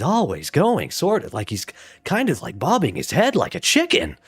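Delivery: talking up and down, roller-coaster pitch; Jason. Acted. Streamer explaining the actor's trick, in exaggerated up-and-down voice. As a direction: bob sharply high and back, like a chicken's head. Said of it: "he calls it talking up and down".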